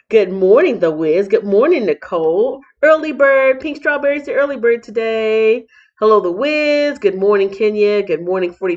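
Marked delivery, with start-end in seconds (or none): 2.8-5.7 excited. Real, not acted. Cheering early viewer.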